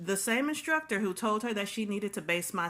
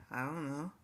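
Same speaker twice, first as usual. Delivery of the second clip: much softer, lower, sheepish; meek mumble; 4 semitones lower, 9 dB quieter than usual. Retold or imitated. Trainee answers the instructor, meek and much softer. The stylist caught out.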